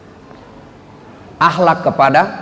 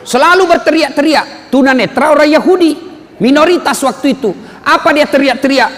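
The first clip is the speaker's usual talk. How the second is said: ordinary delivery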